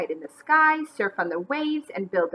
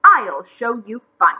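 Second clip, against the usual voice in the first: loud challenge, starting high; angry shout; 10 semitones higher, 8 dB louder than usual. A narrator's reading. McGreely challenging bunnies to compete, angry shout. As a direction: shout it as a threat. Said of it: "he shouted".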